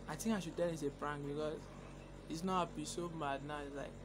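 quiet aside to viewers; hushed aside. Real, not acted. Aside to viewers during call.

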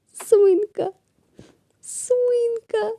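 high, upset calling; high anguished calls; distressed calling. Acted. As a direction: cry out for the pet. Pet crying.

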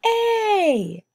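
"A" said high and loud, held long. Shown as a demonstration. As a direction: sing out the vowel "A" clearly. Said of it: "Can you say it with me?".

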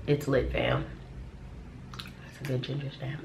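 biting a biscuit; chewing while talking. Real, not acted. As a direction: mouth partly full. Seen 3: Holds ginger biscuit at mouth, chewing, bites it at 4.2s.

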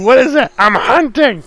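startled hunter. Acted.

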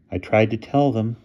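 ordinary delivery

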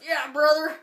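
loud mock wrestler praise; Hulk Hogan. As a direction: roar it like a pumped-up TV host. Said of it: "he's like".

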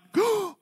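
loud, high gasp; imitated shocked gasp. Seatmate seeing the cup photo, imitated loud gasp. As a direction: suck in air sharply, loudly.

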